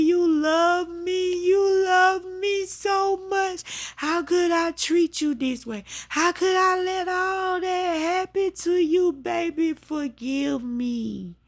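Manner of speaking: high, slow wail imitating her mother; retold anguished plea, long stretched words; remorseful begging. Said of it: "She was rocking".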